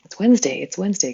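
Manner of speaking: excited answer. Real, not acted. Answers own question brightly.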